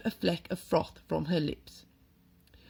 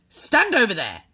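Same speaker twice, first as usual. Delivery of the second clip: loud, higher, curt command; barked order; 6 semitones higher, 10 dB louder than usual. A narrator's reading. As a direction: order him aside sharply. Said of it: "the Trunchbull ordered".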